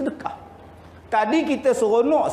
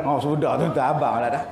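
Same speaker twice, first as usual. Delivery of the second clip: ordinary delivery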